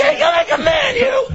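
teasing shout. Real, not acted. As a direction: yell it at him, teasing. Teasing friend in pain.

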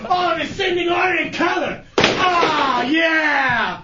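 hammed-up bellowing, drawn-out oh yeahs; sarcastic mock zeal. Chunks making a show of cleaning chairs, sarcastic hollering. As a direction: overplay your delight in the chore.

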